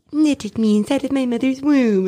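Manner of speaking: high, singled-out mocking quote; cheesy mock recital. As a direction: pitch it way up, making fun of the wording. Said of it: "just the way it was said".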